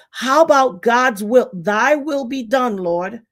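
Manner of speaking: slow preacher cadence; slow it down like a sermon; preachy. Acted. Preaching about God's will.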